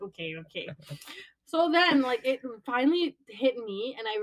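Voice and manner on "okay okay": soft, worn-out giving in; resigned okay. Retold or imitated. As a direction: mumble okay just to end the call. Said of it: "I'm like".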